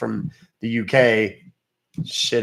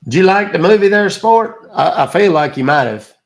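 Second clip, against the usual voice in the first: mock taunting voicemail; 7 semitones higher, 10 dB louder than usual. Mocking a scammer on voicemail.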